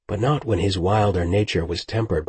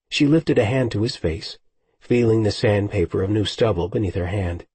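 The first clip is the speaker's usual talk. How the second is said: ordinary delivery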